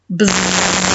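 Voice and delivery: low buzzing zzz; teacher buzzing for the class; bumblebee buzz. Shown as a demonstration. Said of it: "Let's be a bumblebee".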